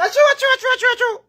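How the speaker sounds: high, loud, laughing chant; laughing mock agreement.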